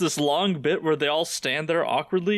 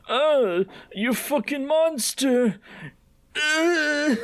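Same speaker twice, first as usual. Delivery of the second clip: mock outrage; 11 semitones higher, much slower than usual. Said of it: "like, straight up".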